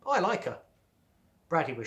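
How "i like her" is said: higher-pitched, lightly bold declaration; a touch of boldness; bold statement. A narrator's reading. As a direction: state it boldly, with mild defiance. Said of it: "then boldly stated".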